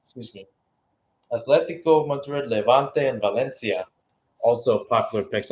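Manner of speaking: normal talking voice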